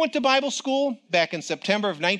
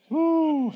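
high laughing whoop; joyful whoop; 11 semitones higher than usual. Real, not acted. Preacher rejoicing before the congregation, laughing whoop. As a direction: burst out with joy.

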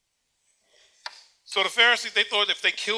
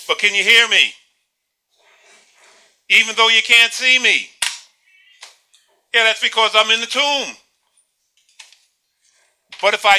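louder, calling out as if shut in a tomb; 7 dB louder than usual. Pretend. Preacher pretending to speak from the tomb, calling louder. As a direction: project it as though buried and calling out. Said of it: "speak loudly so I can hear you".

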